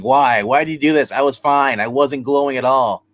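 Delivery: loud, pleading retold complaint; anguished protest. Tormented glowing man asks his captors why, anguished protest. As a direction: protest forcefully, acting out the wronged man.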